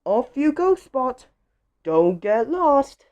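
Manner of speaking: high, singsong motherly send-off; animated mum voice for a small child; storybook mum. A narrator's reading. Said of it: "Spot's mom says".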